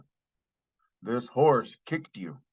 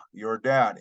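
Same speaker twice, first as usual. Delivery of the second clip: ordinary talk, nothing marked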